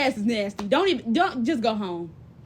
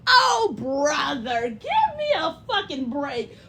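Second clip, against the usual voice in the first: exasperated groan; much slower than usual. Real, not acted. Exasperated at a dude's excuse.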